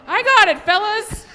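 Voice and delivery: a loud, high call across the field. Acted. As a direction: yell it out proudly to the team. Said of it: "she called".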